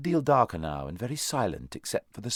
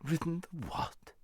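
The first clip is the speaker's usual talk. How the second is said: high, soft contemptuous retort; disbelieving, scornful question, pitched up; extreme contempt; 10 semitones higher, 6 dB quieter, slower than usual. A narrator's reading. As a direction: query it with utter disdain. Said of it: "retorted the horse with extreme contempt".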